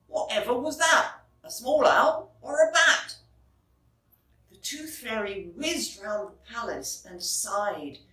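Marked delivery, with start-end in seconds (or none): 0.1-3.2 louder, quick startled guard. Acted. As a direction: blurt it out alarmed. Guard character voice.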